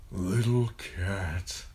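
slow, wispy dying father's voice; thin, pained murmur. A narrator's reading. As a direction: draw it out weakly through pain. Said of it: "murmured in a voice thin and wispy".

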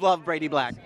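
normal talking voice

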